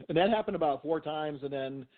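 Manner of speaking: usual speaking voice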